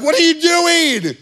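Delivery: high, loud startled shriek of a wife; a wife jumping at finding someone in the dark. Retold or imitated. Wife flips lights on, startled.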